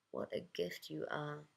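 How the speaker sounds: soft, heartfelt compliment; hushed appreciation; tender praise. Acted. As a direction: say it quietly and sincerely. Narrator tenderly praises the woman.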